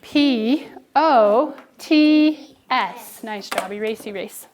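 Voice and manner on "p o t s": each letter drawn out, loud and high; sounding out letters together, very slow. Shown as a demonstration. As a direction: spell it out slowly for the class to echo. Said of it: "Say it with me".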